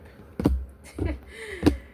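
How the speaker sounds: a long, high laugh; high drawn-out laugh. Real, not acted. Reader cracking up at the viewer's situation, long high laugh. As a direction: let a long laugh roll out.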